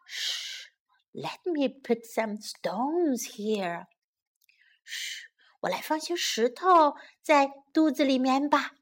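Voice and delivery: soft, secretive storybook hush; quiet acted plotting. A narrator's reading. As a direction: keep it down so the sleeper won't wake. Mother hushes over sleeping wolf.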